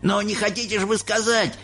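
high, incredulous outcry at normal volume; cried out. A narrator's reading. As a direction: protest in a high, disbelieving voice. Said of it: "закричал дедушка Джо". (высокий недоверчивый выкрик)

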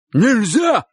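high, loud sudden command; thunderous voice. A narrator's reading. Uncle halting the crowd of boys, thunderous shout. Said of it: "раздался громовой голос дяди Коли".

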